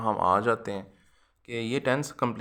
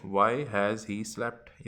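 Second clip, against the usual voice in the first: slow dictation; much slower than usual. Shown as a demonstration. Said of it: "याद रखिएगा".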